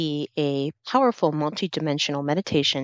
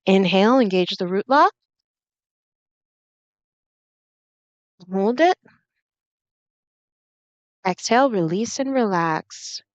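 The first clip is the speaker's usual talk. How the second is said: breathwork coaching; 5 semitones higher, 4 dB louder than usual. Acted. Meditation guide leading root lock breaths, breathwork coaching. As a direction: lead the breath with lifted, stretched cues.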